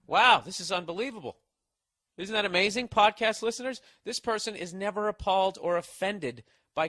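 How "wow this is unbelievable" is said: ordinary talk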